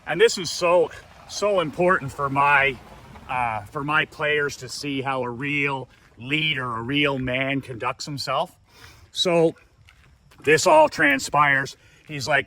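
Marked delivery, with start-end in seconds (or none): none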